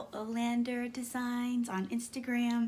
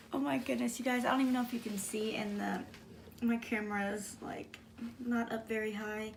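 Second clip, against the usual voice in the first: ordinary delivery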